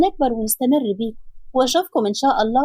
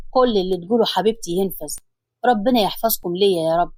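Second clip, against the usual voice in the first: lower, tender husband's voice; loving man's voice, lower; Hassan's voice; 5 semitones lower than usual. Acted. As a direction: soothe her as her husband, lower.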